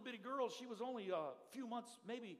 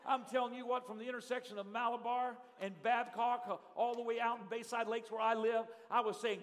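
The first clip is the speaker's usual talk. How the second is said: ordinary delivery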